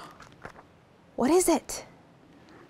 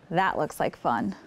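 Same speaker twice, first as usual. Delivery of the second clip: usual speaking voice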